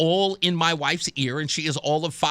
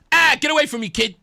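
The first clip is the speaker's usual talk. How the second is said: loud, high dismissive retort; imitated impatient dismissal; mock brush-off; 7 semitones higher, 4 dB louder than usual. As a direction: shoo someone away loudly. Retold brush-off at first meeting.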